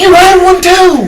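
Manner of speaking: high, loud retort; shouting back. Real, not acted. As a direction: fire it back loudly.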